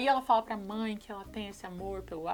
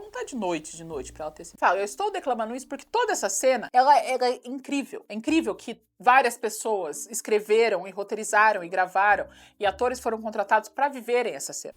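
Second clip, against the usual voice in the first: ordinary talk, nothing marked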